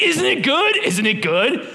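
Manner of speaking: mock pushy health fan, high; eager health nut. Kids pushing healthy food on him, pushy. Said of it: "It's like".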